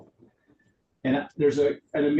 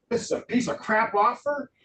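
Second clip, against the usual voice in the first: much higher, hammed-up scorn; mock disgusted seller; 10 semitones higher than usual. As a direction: scoff at the offer, pitched way up. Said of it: "That's your seller going".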